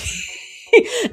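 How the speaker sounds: a real high-pitched laugh breaking out; high burst of laughter. Real, not acted. Comedian laughing at her own baby bit, a high real laugh. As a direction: laugh out loud at the absurd joke.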